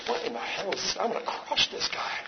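hushed, barely voiced threat; mock seething whisper. Thinking about the man in his face, seething whisper. Muttered threat to himself, retold.

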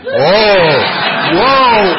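a loud, high, hammed-up whoa; big mock gasp of surprise; mock amazement. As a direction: play up the shock at the comeback. Crowd laughs, mock amazement.

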